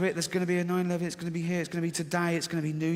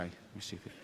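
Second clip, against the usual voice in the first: rational inner voice; 11 semitones lower, 18 dB quieter than usual. Retold or imitated.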